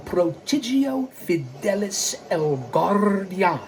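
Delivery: slow, showy Spanish pronunciation with rolled R; Spanish accent. Shown as a demonstration. Host giving his heritage account name, slow Spanish accent. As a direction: announce it grandly in a Spanish accent. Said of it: "in honor of my heritage".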